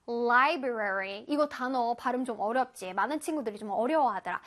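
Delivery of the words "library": library, each syllable drawn out slowly; slow syllable drill. Shown as a demonstration. Teacher modeling library slowly for children. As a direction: stretch out library for the kids. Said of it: "One more time".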